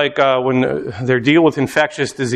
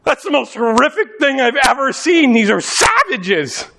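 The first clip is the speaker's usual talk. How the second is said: disgusted Pharisee; 6 semitones higher than usual. Retold or imitated. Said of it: "the Pharisees are like".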